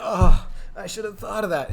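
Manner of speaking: loud, high burst of self-reproach; frustrated groan. Retold or imitated. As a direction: groan it out, kicking yourself. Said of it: "frustrated and, and, like, like I'm dumb".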